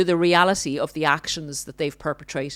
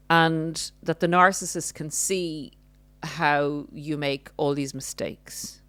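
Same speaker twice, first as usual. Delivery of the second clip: ordinary talk, nothing marked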